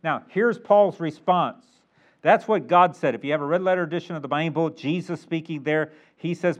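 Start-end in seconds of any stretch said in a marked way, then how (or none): none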